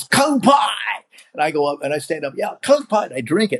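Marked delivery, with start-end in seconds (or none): 0.0-0.5 high shouted toast Kung Pai. Retold or imitated. Grandfather toasting the guest, high and shouted. 2.4-3.0 answering toast. Retold or imitated.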